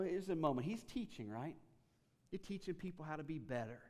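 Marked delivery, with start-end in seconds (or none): none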